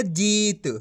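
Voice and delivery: जीत with a drawn-out ee; long ī. Shown as a demonstration. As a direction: stretch the vowel of जीत. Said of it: "खींच के पढ़ा जाता है".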